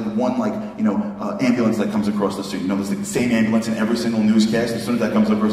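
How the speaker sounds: normal talking voice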